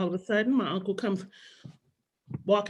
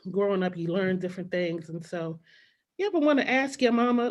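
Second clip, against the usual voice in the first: ordinary talk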